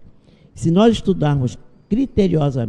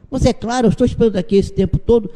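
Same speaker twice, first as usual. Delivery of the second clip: higher, louder, fast indignant outburst; angry patient; 8 semitones higher, 6 dB louder, faster than usual. Retold or imitated. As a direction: snap back like an impatient, offended patient. Angry patient shouts at doctor.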